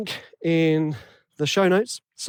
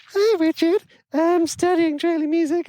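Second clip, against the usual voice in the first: fake listener voice; 12 semitones higher than usual. The host fakes a listener's question in a high silly voice. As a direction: squeak it out as a pretend fan asking a question. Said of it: "me putting on a fake voice".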